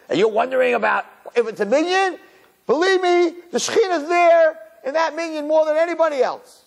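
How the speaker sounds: high, pleading disbelief; incredulous retort. Retold or imitated. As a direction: throw the question back, outraged and pitched high. Exasperated retort to the woman.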